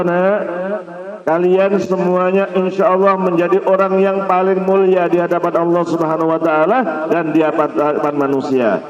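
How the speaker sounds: normal talking voice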